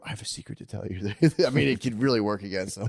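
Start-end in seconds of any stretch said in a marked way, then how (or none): 0.0-1.1 soft, quick, hushed line; hushed secret. Shown as a demonstration. Dad luring the kids with a soft, quick, hushed secret. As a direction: draw them in softly with a secret. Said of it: "if you whisper".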